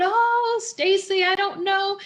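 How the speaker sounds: high-pitched, playful whine of protest; reluctant mock wail. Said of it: "I went in kicking and crying".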